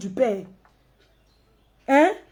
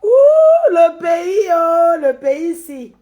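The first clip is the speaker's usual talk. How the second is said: loud lament; 2 semitones higher, 8 dB louder, much slower than usual. Real, not acted. Vlogger despairing over the country, loud lament. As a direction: wail it out loud, letting it fall away.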